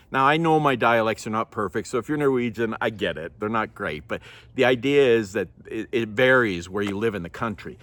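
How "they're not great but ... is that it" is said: ordinary delivery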